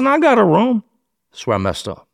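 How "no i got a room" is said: defensive protest. Retold or imitated. Defensive answer to detective.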